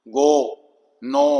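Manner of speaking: go, no with a long rounded O; long O sound. Shown as a demonstration. Teacher showing how the sound lengthens, long O. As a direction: stretch the O in "go, no". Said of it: "अब लंबा हो गया sound".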